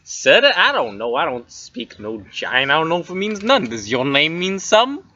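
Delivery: brash weasel with swooping pitch; weaselly voice. Acted. Weasel dodging a question about the child's name, weaselly voice. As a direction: rattle it off like a street-tough weasel. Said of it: "It's a weaselly voice".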